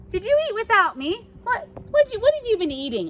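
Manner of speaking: surprised accusation. Retold or imitated. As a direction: catch her off guard. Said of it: "she stops and she's like".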